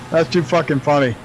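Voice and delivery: usual speaking voice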